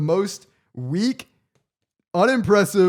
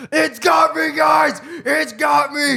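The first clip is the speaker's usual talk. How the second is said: much higher, louder frantic shouting; hammed-up panicked yell of a crazy neighbor; crazy neighbor panicking; 11 semitones higher, 5 dB louder, slower than usual. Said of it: "He's like".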